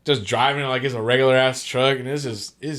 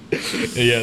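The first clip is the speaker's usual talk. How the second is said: ordinary delivery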